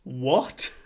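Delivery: loud shocked what rising up. Retold or imitated. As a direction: burst out in disbelief. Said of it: "reacting more and more shocked".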